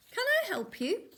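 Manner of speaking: put-on high, dainty old lady voice; giant old lady. A narrator's reading. Huge granny greeting the wolf, high sweet old lady voice. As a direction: sweetly ask from the doorway.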